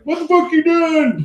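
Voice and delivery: high, loud angry yell; angry general. Acted. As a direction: bark it like a furious general. Pissed off elven general.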